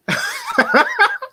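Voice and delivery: short, very high cackle; laughing. Real, not acted. Laughing at the aging quarterback joke, high cackle. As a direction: burst out at your own joke.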